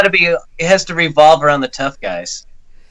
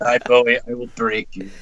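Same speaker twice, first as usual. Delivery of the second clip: Russian tough guy; 7 semitones lower, 6 dB quieter than usual. Retold or imitated. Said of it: "like, Rocky Four".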